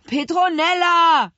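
high, loud shout for help; long, far-carrying child's holler; full-throated calling. A narrator's reading. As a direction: yell the name at the top of your lungs. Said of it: "riefen die Zwillinge aus voller Kehle".

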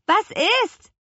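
high, loud call from a window; shouted question to someone below. A narrator's reading. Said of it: "rief Petronella".

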